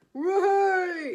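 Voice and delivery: high, loud "Whey!" whoop; lads' cheer. Retold or imitated. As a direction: whoop like lads at a bar when a glass breaks. Lads cheering at the crash.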